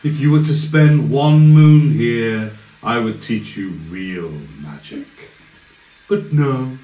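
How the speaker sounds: scornful villain. Acted. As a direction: belittle the boy wizard, then go cold. Villain taunts the party.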